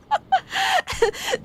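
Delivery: very high, wheezy-squeal laughter; real laughing fit. Real, not acted. Teller still tickled by own joke, real helpless high laughter. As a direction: keep cracking up, high and helpless.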